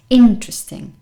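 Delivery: interesting as IN-tresting, middle vowel gone; loud first syllable in interesting, no middle vowel; dropped middle vowel. Shown as a demonstration. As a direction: say interesting in three syllables, IN-tres-ting. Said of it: "So imagine it wasn't there".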